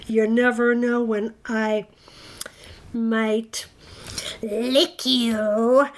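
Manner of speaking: hammed-up monster menace, with long pauses; would-be terrifying monster, drawn out; trying to sound terrifying. A narrator's reading. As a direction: build to a loud mock threat. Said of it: "he said, trying to sound terrifying".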